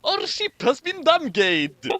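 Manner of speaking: high-pitched attempt at a Russian accent; lightly attempted Russian officer voice. Crew member reporting damage to the captain, in a Russian accent. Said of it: "I don't know if that sounded even remotely Russian".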